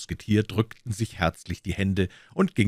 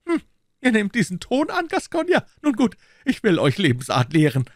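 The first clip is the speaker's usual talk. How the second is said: very high, louder offended voice; indignant threat; 14 semitones higher, 5 dB louder than usual. A narrator's reading. Aramis threatening to teach the Gascon manners, indignant. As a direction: threaten him in a sharply raised pitch.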